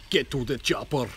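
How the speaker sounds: mock German accent, film-quote style. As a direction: try a German accent that lands near Austrian. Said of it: "German accent".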